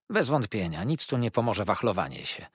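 ordinary delivery

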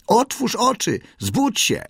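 high, piercing wake-up call; high-pitched shout over a din. A narrator's reading. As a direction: cry out high to rouse a sleeper. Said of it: "krzyknął Kotik".

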